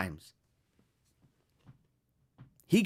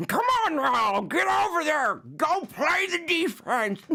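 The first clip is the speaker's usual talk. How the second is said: put-on high, strained old-man coach voice, slowing at the end; wheezy-sounding old coach yelling from the bench; 8 semitones higher than usual. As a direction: bark orders like an eighty-year-old coach. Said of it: "your coach is, like, eighty now".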